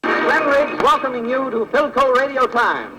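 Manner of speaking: ordinary talk, nothing marked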